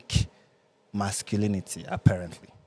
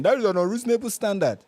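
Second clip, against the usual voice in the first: mocking men; 8 semitones higher, 3 dB louder than usual. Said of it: "the men are saying".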